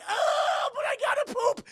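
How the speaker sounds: high, loud wailing complaint; mock whiny protest. Evacuees protesting to the man clearing them out, loud wail. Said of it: "And they're like".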